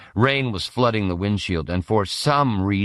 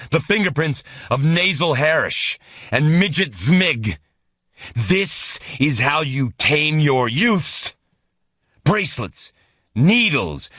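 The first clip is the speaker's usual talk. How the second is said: furious tirade; 7 semitones higher, 3 dB louder than usual. Acted. General's furious tirade continues.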